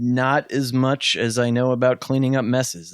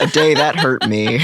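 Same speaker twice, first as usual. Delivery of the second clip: laughing; 5 semitones higher, 5 dB louder than usual. Real, not acted. Laughing through the joke.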